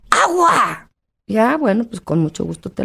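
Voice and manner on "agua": put-on high, bright cry of a child finally saying the word; child's eager cry. Mother voicing her son crying out his new word. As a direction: shout the word like a small boy who just learned it. Son's first word retold.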